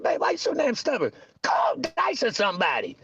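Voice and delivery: a hint of an exasperated wife. Husband imitating his wife telling him to call Dice, exasperated. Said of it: "She said".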